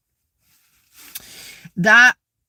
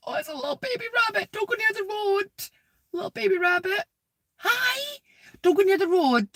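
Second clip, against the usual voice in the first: cooing to animal; 13 semitones higher, faster than usual. Real, not acted. Woman warns baby rabbit off the road, high cooing. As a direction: coo a high, anxious plea.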